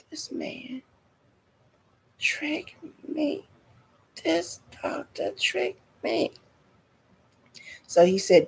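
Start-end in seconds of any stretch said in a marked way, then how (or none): 0.1-6.3 very soft, high, wounded little voice; hushed betrayal. Retold or imitated. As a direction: squeak it out under the breath, betrayed. Acts out feeling betrayed.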